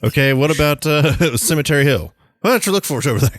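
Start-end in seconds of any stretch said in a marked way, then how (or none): 2.4-3.4 higher mock ghost soldier. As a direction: point the way as the ghost. Friend imitating the ghost.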